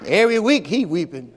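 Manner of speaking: teasing with a laugh in the voice; laughing tease. Retold or imitated. Teacher teasing the boy who always says Jesus wept, laughing. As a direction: poke fun at the weeping Jesus boy. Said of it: "I really said".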